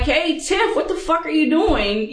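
playful cussing banter; jokingly cursing. Pretend. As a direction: tease a coworker with mock outrage. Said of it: "now you jokingly being like".

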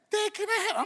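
put-on high sweet child request; lightly voiced child asking. Son asking dad for something minutes after biting, light high child voice. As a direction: ask dad for a treat like nothing happened.

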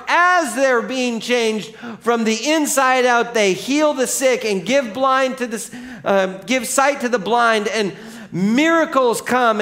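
loud, high, incredulous build; full-force preaching. Real, not acted.

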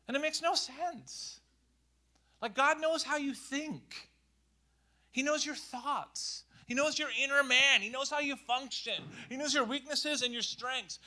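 high, loud passionate preaching; impassioned sermon peak; full-force preaching. Real, not acted. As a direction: preach it out, rising intensity.

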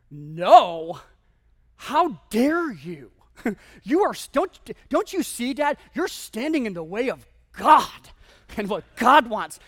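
loud, slow mock fury at dad; hammed-up teen outrage. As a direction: rant like an indignant teenager, overplayed. Teen furious at dad's refusal.

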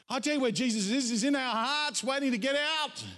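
high, emphatic preacher's answer; rousing preaching. Real, not acted. Preacher answering the doubters about Jesus, rousing high exclamation. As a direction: lift the pitch and drive it home.

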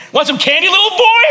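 mock creepy candy offer, high; hammed-up creepy stranger voice. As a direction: play the sketchy stranger with candy.

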